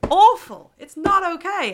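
loud, high burst of indignation; host outraged for the guest, loud high indignation. Real, not acted. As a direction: blurt it out in real outrage. Outraged at his not feeling English.

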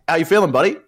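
a mock-chirpy roommate checking in; cheery roommate. Roommate voiced greeting him.

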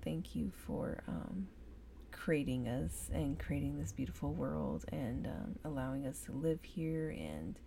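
soft, earnest prayer voice. Real, not acted. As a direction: pray quietly, from the heart. Leads a spoken prayer.

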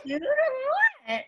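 high, loud gushing over something cute; gushing delight. Real, not acted. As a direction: squeal it with delight. Gushing over the double act's name.

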